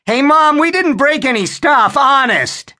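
put-on boy yelling to mom downstairs; yelled. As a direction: holler innocence down the stairs. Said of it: "Harry yelled".

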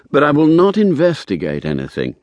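quick, emphatic caveat; quick insistence. A narrator's reading. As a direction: jump in firmly to refuse. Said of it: "I said quickly".

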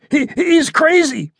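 high, slow stammering terror; frightened stammer. A narrator's reading. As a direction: stutter it out, scared stiff. Terrified captive stammering.

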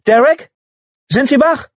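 loud, high call up the stairs. A narrator's reading. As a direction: call out to someone upstairs. Calling upstairs to patient.

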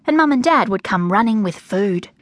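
usual speaking voice

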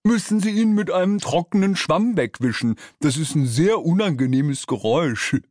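third demon underling. A narrator's reading. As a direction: explain it earnestly as a silly minion. Third demon continues the idea.

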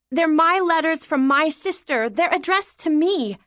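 put-on high-pitched, insistent young girl; indignant child. Daughter claiming her sister's letters from mother, indignant. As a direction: protest like a wronged child.

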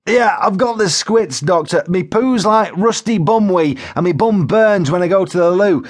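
high-pitched, unembarrassed wailing complaint; Joe Windass, distressed shout. A narrator's reading. Joe lists his symptoms to the paramedic, shouting in distress. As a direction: tell it like it is, in distress. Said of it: "he shouted, as if in distress".

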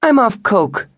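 high, loud and haughty; lofty, commanding retort; imperious. A narrator's reading. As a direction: declare it from on high. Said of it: "she stated imperiously".

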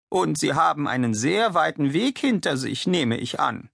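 put-on a high, curious old lady's voice with the stress on "hinter"; old lady guessing about her guest's long journey; Misses Whittaker. A narrator's reading. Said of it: "Misses Whittaker talks with Galahad".

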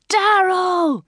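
high, loud call of a name; piercingly high, drawn-out hail; excited scream. A narrator's reading. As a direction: scream it out to a friend across a crowd. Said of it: "screamed somebody".

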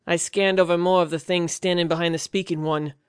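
usual speaking voice

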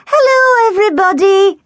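put-on drunken greeting. A narrator's reading. Sandy drunkenly greeting guests at her party, gushing. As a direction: sing out the hello like the drunk bride-to-be. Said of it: "she greeted them".

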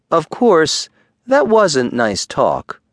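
plain reading voice

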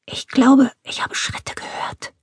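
urgent hushed warning, sinking to a bare whisper; nervous whisper. A narrator's reading. Burglar warning his partner of footsteps, nervous whisper. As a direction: whisper it, afraid of being heard. Burglar fears someone has heard them.

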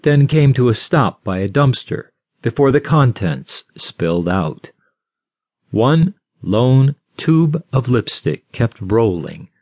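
ordinary delivery

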